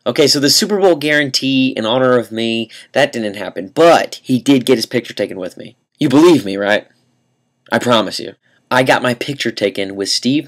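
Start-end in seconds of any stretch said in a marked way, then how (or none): none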